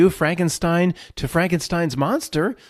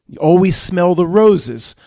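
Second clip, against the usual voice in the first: ordinary talk, nothing marked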